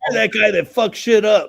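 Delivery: loud, high-pitched imitation of a boss; mock boss outburst. Co-worker guessing what the boss said, mock outburst. Guessing boss's quote, laughing crowd.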